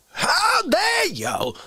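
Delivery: drawn-out, high-pitched indignation; hammed-up indignant cry; mock outrage. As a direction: stretch it out, high and affronted. Mock outrage at someone in chat.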